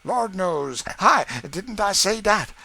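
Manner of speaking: hammed-up dismay; mock apprehension. A narrator's reading. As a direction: catch your breath in mock fright. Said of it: "ludicrous flourish of apprehension".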